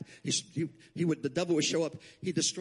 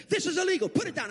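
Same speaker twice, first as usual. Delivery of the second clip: high, bossy inspector voice; mock official order; 13 semitones higher than usual. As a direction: snap out the order like a petty official.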